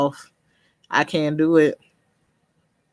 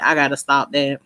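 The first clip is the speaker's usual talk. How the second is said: ordinary delivery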